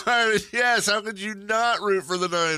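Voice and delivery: high, loud incredulous outburst; loud, high-pitched disbelief; animated exclamation. Real, not acted. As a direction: exclaim it at the top of your range. Exclaiming over the Alex Jones likeness.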